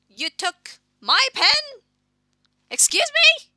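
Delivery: high, loud, slow outraged cry; outraged shriek. Acted.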